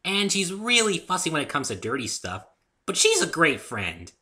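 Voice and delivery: high, loud eager pony chatter; bubbly Pinkie; cheery friend praise. A narrator's reading. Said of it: "Pinkie speaking".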